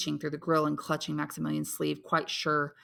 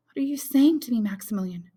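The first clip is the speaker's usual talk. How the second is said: high, louder startled exclamation; exclaiming; 6 semitones higher, 6 dB louder than usual. A narrator's reading. Valentine startled by Maximilian's suggestion, high shocked exclaim. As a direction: burst out in shocked disbelief. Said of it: "she exclaimed".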